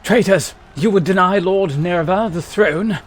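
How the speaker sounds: loud soldier speaking inside a helmet. Acted. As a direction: bark it through a helmet. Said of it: "we gotta do the helmet voice for these guys".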